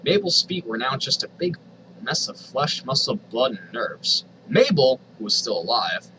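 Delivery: ordinary talk, nothing marked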